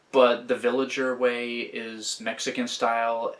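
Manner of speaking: normal talking voice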